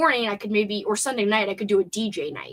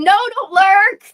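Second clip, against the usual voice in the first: playful protest; 10 semitones higher, 11 dB louder than usual. Real, not acted. Protesting viewer's lurking.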